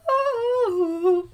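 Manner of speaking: very high, loud "Oh"; a startled high oh; surprised gasp. Retold or imitated. Fairies hearing a sound, very high startled oh. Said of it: "they hear a".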